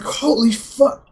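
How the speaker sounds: high, drawn-out cry of shock; retold pained outcry. Reacting to a friend's kick in the shin, pained. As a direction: stretch it out like shin pain, imitated. Retelling being kicked in shin.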